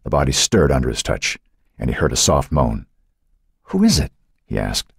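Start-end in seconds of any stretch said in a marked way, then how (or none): 3.6-4.1 anxious question. A narrator's reading. Boy questions unknown body in dark.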